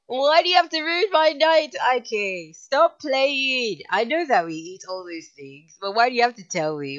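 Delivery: loud, high whining protest; mock anguish. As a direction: plead loudly as if the night is ruined. Mock complaint to message writer.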